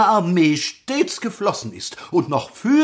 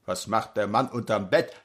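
clipped nasal officer; 8 semitones lower, 7 dB quieter than usual. Acted. Lieutenant asks.

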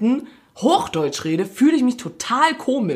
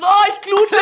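hoch und laut, gespieltes Jammern; gespielter Schmerz; 12 semitones higher, 6 dB louder than usual. Pretend. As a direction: jammer übertrieben über den verletzten Finger. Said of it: "man hat sich im Finger gestimmt". (gespielter Schmerzensruf, höher und lauter)

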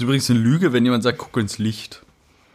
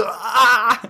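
pained cry; 9 semitones higher than usual. Retold or imitated. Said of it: "Ich so".

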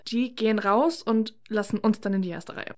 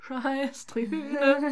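mock jingle; 4 semitones higher, much slower than usual. Mock sound-effect insert, drawn out.

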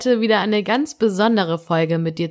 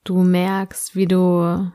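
sleep meditation; slower than usual. Acted. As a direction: guide the listener gently into sleep.

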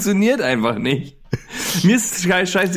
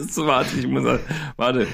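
ordinary talk, nothing marked

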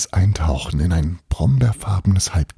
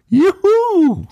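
high, loud whoop of delight; boy whooping as the train swoops; joyful shout; 5 dB louder than usual. A narrator's reading. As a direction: cheer like a kid on a rollercoaster. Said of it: "schrie er".

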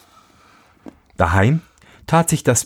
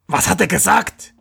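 high, eager miller voice; eager question; 9 semitones higher than usual. A narrator's reading. Miller asks what the raven prophesied, eagerly curious. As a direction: ask it high, itching to know.

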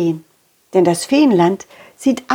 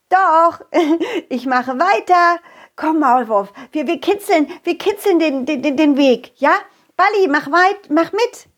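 playful; 7 semitones higher than usual. Acted. Playful tickling.

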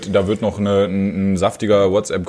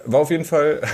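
ordinary talk, nothing marked

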